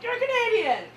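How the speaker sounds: high, loud mock accusation; gleeful exposing. Viewer spots a Canadian actor, accusing. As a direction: call them out in a high voice.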